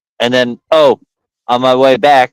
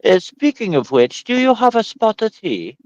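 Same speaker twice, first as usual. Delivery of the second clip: high, mock-polite English voice; put-on English accent, raised pitch; 9 semitones higher than usual. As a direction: offer it in hammed-up English politeness. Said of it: "start talking with your English accent".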